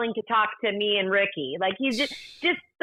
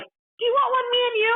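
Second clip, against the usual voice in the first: much higher, gentle mock-sweet offer; 13 semitones higher than usual. Star offers her a photo, voiced much higher. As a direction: imitate him kindly. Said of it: "he was like".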